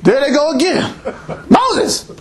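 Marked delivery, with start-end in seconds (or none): 1.5-2.1 high, drawn-out hungry whine; whining Israelites. Retold or imitated. Preacher voicing hungry Israelites begging Moses, high whine. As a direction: plead for meat like a whiny crowd.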